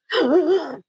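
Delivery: imitated sobbing gasps for air; hyperventilating gasp. Speaker imitating a crying woman at her talk, hyperventilating gasp. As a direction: mimic crying so hard you hyperventilate. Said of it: "she was hyperventilating".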